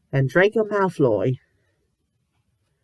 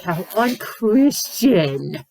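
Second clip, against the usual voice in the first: mock pious shock; 3 semitones higher, much slower than usual. Mocking the story's morals.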